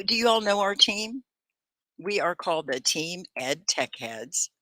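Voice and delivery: ordinary talk, nothing marked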